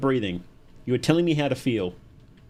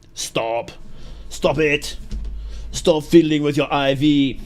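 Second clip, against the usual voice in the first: mock German scolding, building louder and slower; heavy German accent; 4 dB louder, much slower than usual. Imitating the medic scolding a patient fiddling with an IV, heavy German accent. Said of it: "in a heavy German accent".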